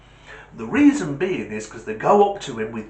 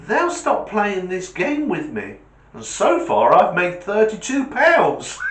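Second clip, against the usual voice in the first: put-on kid voice; 6 semitones higher, 3 dB louder than usual. Jimmy answering the neighbour.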